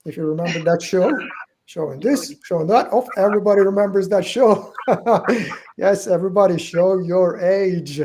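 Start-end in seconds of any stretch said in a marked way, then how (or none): none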